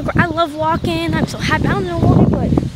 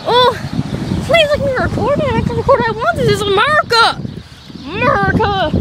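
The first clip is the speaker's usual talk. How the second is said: indignant shout; 8 semitones higher than usual. Real, not acted. Defiant about being filmed.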